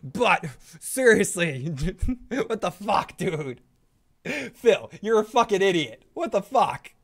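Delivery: hammed-up disbelief, drawing out the opener; Joe. Parodied ranter berating the host, mock exasperated. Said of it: "Joe Dirty Locks".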